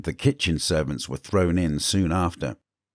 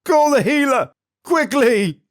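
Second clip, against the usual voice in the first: very high, loud urgent cry; queen screaming for the healer; frantic shout; 7 dB louder than usual. Acted. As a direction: yell for help in panic.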